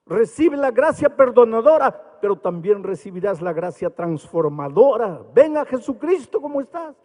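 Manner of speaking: a lighter echo of the preaching, rising up; half-voiced revival plea; preaching altar call. Preaching altar call in retold scene.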